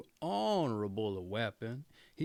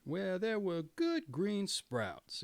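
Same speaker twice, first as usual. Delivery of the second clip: plain reading voice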